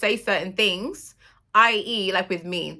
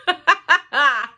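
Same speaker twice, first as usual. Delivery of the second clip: emotional laugh; 9 semitones higher, 9 dB louder than usual. Real, not acted. Nervous laugh through emotion.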